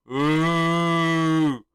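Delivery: long, loud held ooh; sustained mock booing; imitated crowd jeer. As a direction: boo along like an arena crowd. Mimics crowd jeering.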